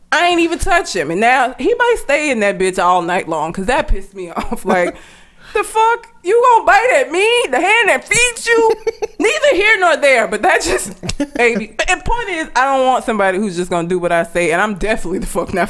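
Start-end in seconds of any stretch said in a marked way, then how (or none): 5.5-9.5 mock scolding. Scolding her dog that bit her.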